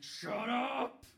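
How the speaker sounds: loud, high snapped shout; retold hushed fury turned shout; sharp shout. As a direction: cut him off with a yell. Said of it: "It's like".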